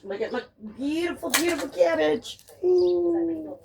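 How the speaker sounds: high, soft cooing over the cabbage; delighted cooing. Real, not acted. Admiring her cabbage.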